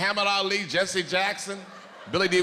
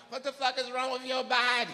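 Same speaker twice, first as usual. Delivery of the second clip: high questioning woman; 9 semitones higher than usual. Acted.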